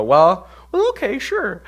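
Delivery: very high-pitched, uncertain acted reply; mock-reluctant, voice climbing way up; hesitant agreement. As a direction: squeak out a doubtful yes. Acts out hesitant agreement to coach.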